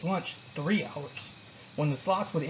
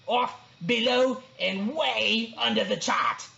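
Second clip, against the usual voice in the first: higher, slow ranting verdicts; booming boar; 4 semitones higher, slower than usual. A narrator's reading. Boar reading the bad report, booming. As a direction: boom out each grade with scorn. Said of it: "the wild boar boomed on".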